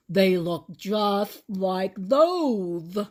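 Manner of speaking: slow, loud lisping accusation; suspicious toothless growl. A narrator's reading. Grandpa accuses the officer, slow loud toothless growl. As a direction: growl it, lisp and all. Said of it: "growled suspiciously".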